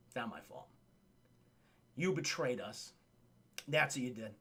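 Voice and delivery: low, soft and grave; subdued retort. Retold or imitated. Goliath answering the accusation of fault, subdued. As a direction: answer quietly with acted hurt.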